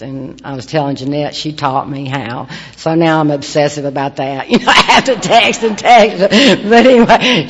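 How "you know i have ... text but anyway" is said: higher and louder, laughing. Real, not acted. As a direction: giggle through it at herself. Laughs at own texting obsession.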